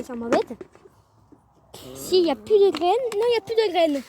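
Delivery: ordinary talk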